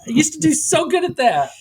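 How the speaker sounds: high, comic wail; mock lament. Lamenting a lost knack for accents, mock wail.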